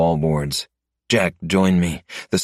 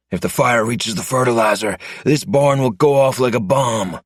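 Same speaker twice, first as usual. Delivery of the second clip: raised, urgent voice over the fire; higher, pressing warning about the fertilizer; 7 semitones higher than usual. Acted. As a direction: warn him fast, the barn is about to blow. Urgent warning in burning barn.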